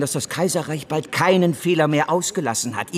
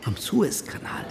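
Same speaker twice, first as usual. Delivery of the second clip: whisper; 4 semitones lower, 8 dB quieter than usual. Acted. Rumour passed among brokers.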